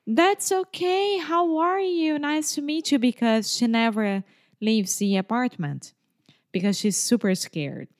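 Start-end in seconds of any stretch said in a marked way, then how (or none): 0.0-3.0 sweeten it up, high and careful; overly polite. Retold or imitated. Polite reply to the scared neighbor, high and sweet.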